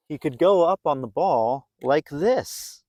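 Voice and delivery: ordinary delivery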